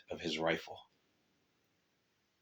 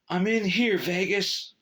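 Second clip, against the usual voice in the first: plain reading voice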